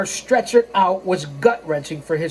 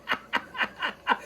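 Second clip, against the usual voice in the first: soft breathy villain cackle; a quick wicked snicker; diabolical laugh; 7 semitones higher, 11 dB quieter than usual. Shown as a demonstration. Narrator to listeners, giving a diabolical laugh. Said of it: "There's that diabolical laugh again".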